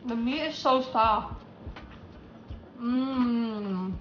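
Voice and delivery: muffled words between bites; talking while chewing. Real, not acted. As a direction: mouth full of taco. Seen 0: Holds taco piece, chewing with full mouth through labelled words.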